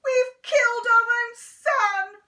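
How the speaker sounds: high, loud mock shriek; hammed-up horrified mother. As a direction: Mum shrieking over her son. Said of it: "Mum would shriek".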